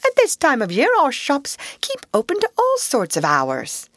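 high, respectful field mouse voice; high-pitched, deferential reply. A narrator's reading. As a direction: explain politely to a superior. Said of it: "replied the Field Mouse respectfully".

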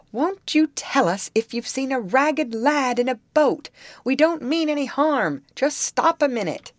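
high, loud appeal; calling out. A narrator's reading. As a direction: call across to the other boat. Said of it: "called out the man".

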